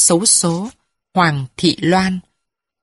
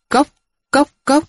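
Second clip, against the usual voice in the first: high clipped tok tok tok of a wooden bell; wooden knock imitation; 8 semitones higher than usual. Crier's wooden bell beating, high vocal knocks.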